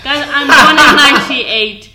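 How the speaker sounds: playful outraged laugh to the camera; laughing protest. Real, not acted. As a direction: protest through a laugh, slow and loud. Protesting age tease, laughing.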